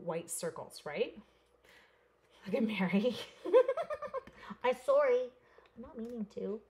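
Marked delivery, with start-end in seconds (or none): 3.2-4.6 real high giggle bursting out; sudden high real laugh; high burst of laughter. Real, not acted. Laughs at Mary on camera.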